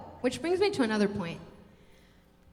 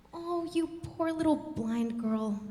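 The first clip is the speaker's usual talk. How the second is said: slow, drawn-out condescending pity; mock sympathy, stretched out slowly; pitying teacher; 3 semitones higher than usual. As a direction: coo as if to a helpless child. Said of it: "kind of attitude".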